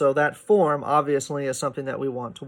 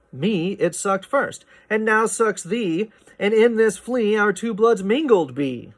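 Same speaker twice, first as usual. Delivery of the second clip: poem reading; 7 semitones higher, 4 dB louder than usual. Shown as a demonstration. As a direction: recite the lines like poetry. Reads the poem aloud.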